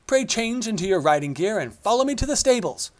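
loud, high, eager command; rally the lords to the hunt; rousing king. A narrator's reading. King rallying his lords to the hunt, eager.

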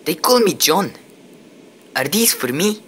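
a hint of a Scottish brogue; strong Scottish accent. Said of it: "The newcomer spoke with a strong Scottish accent".

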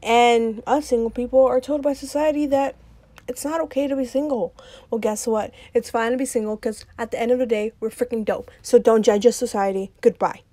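loud, mock-defiant intro rant. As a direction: shout it out to society, cheeky and bold.

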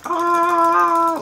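loud, sustained "aah" shooting up high; high held yell. Pretend. Player swinging sword in game, high held yell. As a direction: let out a long battle yell. Cries out during game fight.

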